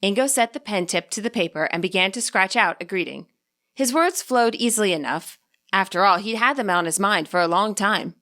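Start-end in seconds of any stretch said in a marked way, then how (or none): none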